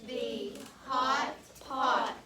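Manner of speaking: "Lawn can see the hot pot" read very slowly, word by word; teacher leading the class to read a sentence; slow choral reading. Shown as a demonstration. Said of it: "try to read this page".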